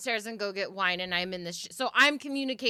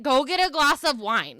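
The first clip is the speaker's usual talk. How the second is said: louder, higher ghostly nudge; spirit urging; 6 semitones higher, 6 dB louder than usual. Retold or imitated. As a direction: push her toward the wine. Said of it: "They're like".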